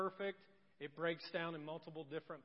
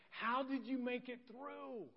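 high, drawn-out amazed question; 9 semitones higher, 4 dB louder, slower than usual. Retold or imitated. Asking someone who came through something horrendous, amazed. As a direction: ask it in astonishment, stretching the words.

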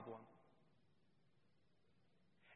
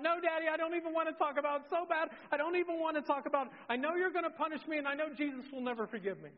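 high, loud, rushed mock wailing; hammed-up tearful refusal in a child's pitch; overcome, crying child; 10 semitones higher, 4 dB louder, faster than usual. Daughter refusing to tell Daddy, imitated distraught crying. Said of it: "She's just overcome by everything".

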